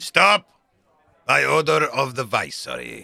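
loud authoritative command; commanding shout. Acted. Astronomer stopping the tavern fight, loud commanding shout. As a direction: halt the room with authority.

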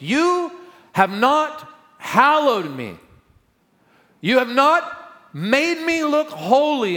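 high, loud, slow divine rebuke; voice of God rebuking. Acted. God rebuking Moses, high and loud. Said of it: "This is what God said to Moses".

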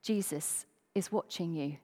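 low, hushed voice from the dark; mysterious warning voice. Acted. Unseen voice to a burglar mid-theft, low mysterious warning. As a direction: drop it low and soft, like an unseen watcher. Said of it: "and he heard again".

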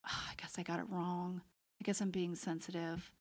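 whispered inner doubt; a small defeated whisper to oneself; inner thought whisper. Retold or imitated.

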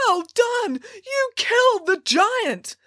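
high, drawn-out cheer; elated congratulation. A narrator's reading. Captain congratulating Bramwell, high elated cry. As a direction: heap on praise, high and thrilled.

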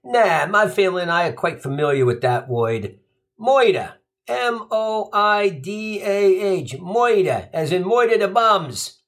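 put-on slow, tough Queens kid spelling; New York accent. Gino spells murder at the bee, thick Queens accent. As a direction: spell it like a wise-guy Italian kid. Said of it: "and he says".

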